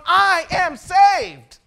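drawn-out, loud proclamation; slow triumphant declaration. Acted. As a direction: stretch every word in triumph. Climax of his testimony.

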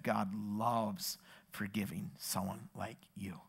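very soft, low and slow; tender reassurance. Retold or imitated. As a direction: gently reassure someone close to tears. Tender answer to a tearful kid.

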